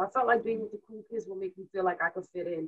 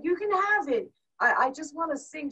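eager; 7 semitones higher than usual. Retold or imitated. Teen giving money to mom.

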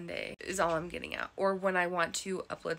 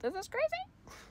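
high and squeaky in disbelief; incredulous squeal. Retold or imitated. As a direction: squeal it, thrown by the plot. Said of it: "And I'm like".

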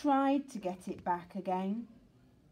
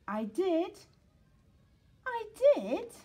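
high, rising, louder cry of "I did"; a pup pleading to be believed, climbing higher; desperate insistence building up; insistent little pup; 11 semitones higher, 5 dB louder than usual. Acted.

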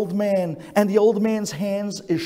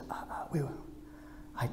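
near-whispered, halting stammer; hushed stammer; 3 semitones lower, 9 dB quieter, faster than usual. Retold or imitated. Grandfather whispers to the Rebbe in embarrassment, stammering.

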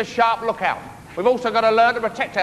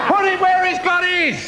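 shouting; 8 semitones higher than usual. Acted. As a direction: yell it in frustration. Captain yells at sailor.

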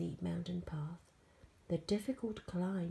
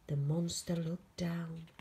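ordinary delivery

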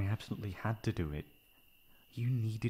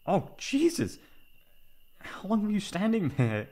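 much higher, louder jolt; startled; 11 semitones higher, 8 dB louder than usual. Acted. Friend caught mid-rant, startled and loud. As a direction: jump at being caught.

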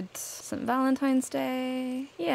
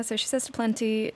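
ordinary talk

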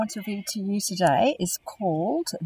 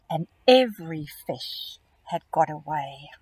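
put-on expressive read-aloud for young children; storybook reading; 4 dB louder than usual. A narrator's reading. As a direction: read it like a picture book to kids. Reading picture book to children.

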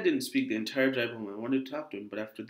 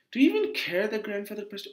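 higher-pitched, accusing outburst; angry; 8 semitones higher than usual. Acted. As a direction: snap at your father in acted anger. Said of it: "it made me feel so angry".

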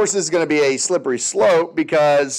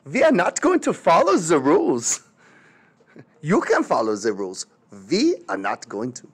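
a hint of German accent, defiant and lower; lightly voiced German dictator refusing; mock German Hitler; 3 semitones lower than usual. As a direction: say "Nine" like nein with smug German defiance. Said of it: "Hitler's like".

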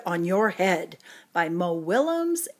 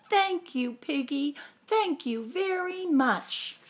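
high, relieved gushing thanks; high grateful gushing; 6 semitones higher than usual. Acted. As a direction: gush thanks to a friend. Elephant thanks his friend.

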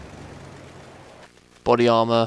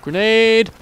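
loud, high drawn-out yell; shouted warning; 10 semitones higher, 7 dB louder than usual. Pretend. Player warning the squad of a grenade, shouted warning.

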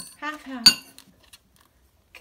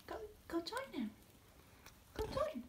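quiet and higher; soft coaxing; 8 semitones higher, 13 dB quieter than usual. Real, not acted. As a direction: gently urge the bird on. Softly coaxing the bird.

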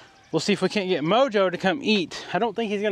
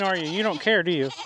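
ordinary delivery

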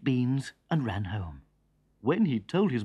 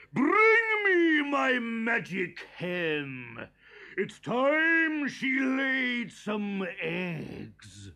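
very slow, higher giant order; giant commanding; 7 semitones higher, much slower than usual. A narrator's reading. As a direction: demand the magic hen. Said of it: "he said".

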